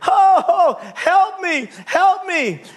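very high, pleading cry; acted panic, calling up from the branch; hollering for help. Dangling man answers the voice from above, panicked holler. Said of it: "Kept hollering for help".

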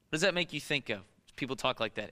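normal talking voice

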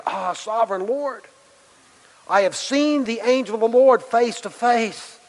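high, awestruck cry; awed exclamation. Acted. Gideon cries out after seeing the angel face to face, high and awestruck. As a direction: cry out in reverent fear. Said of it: "he exclaimed".

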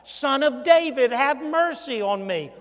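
loud pleading call; blind beggar's cry. Retold or imitated. Blind Bart crying out to Jesus for mercy. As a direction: cry out for mercy over the crowd. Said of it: "No way was blind Bart".

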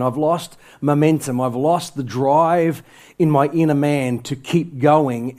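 ordinary talk

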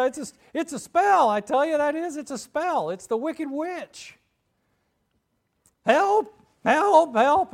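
high, loud frantic Scarecrow; panicked. Acted. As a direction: cry out for help in hammed-up terror. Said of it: "left there to panic".

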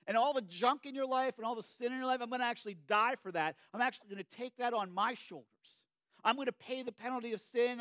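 loud, high preaching as Jesus promises to die for sin; full-force preaching. Acted. Preacher to congregation voicing Jesus' promise, loud and high. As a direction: drive it home from the pulpit, loud and high.

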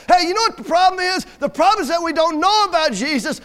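high, loud pulpit rebuke; forceful preaching. Real, not acted. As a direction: hammer the point at the congregation.

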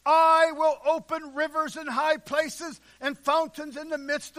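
loud, high, rolling preacher's cry; fiery sermon delivery, voice ringing; full-force preaching. Acted. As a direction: thunder it out from the pulpit.

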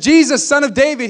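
high, loud cry for help. Acted. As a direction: call out over a crowd hushing you. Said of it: "He just got louder".

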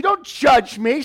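ordinary delivery

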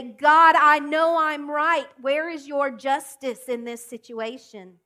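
loud, high protest at God; indignant outcry. Retold or imitated. Believer shouting at God about justice: loud indignant protest. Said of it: "the things that we say".

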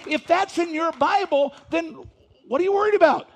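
high, loud pulpit exhortation; full-force preaching. Acted. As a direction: press the congregation hard with each line.

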